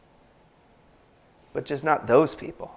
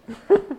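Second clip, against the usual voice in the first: high laugh; 4 dB louder than usual. Real, not acted. Congregation's honest answer.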